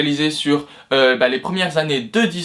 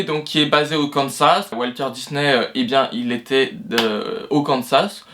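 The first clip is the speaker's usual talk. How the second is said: ordinary talk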